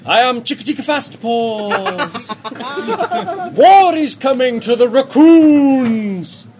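slow, grand talking badger; booming badger statue. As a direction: proclaim it like an ancient beast. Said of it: "It says".

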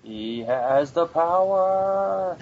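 high, very slow, stretched catchphrase; playful, long-held heroic cry; drawn-out proclamation. Pretend. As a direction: proclaim it mock-heroically, dragging each word out.

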